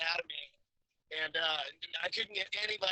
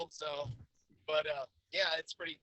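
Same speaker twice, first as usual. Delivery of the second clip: ordinary talk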